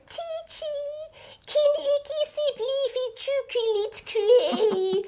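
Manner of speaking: put-on high, slow child's voice asking sweetly; high pleading little-boy voice; little Afrikaans boy. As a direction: imitate a small boy begging for chocolates. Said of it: "that was how English sounded to him".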